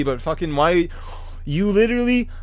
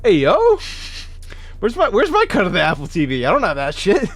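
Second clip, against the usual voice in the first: high, loud, indignant whining demand; mock outrage; 9 semitones higher, 5 dB louder than usual. Streamer to chat demanding his share, mock outraged. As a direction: pretend to be cheated out of your cut.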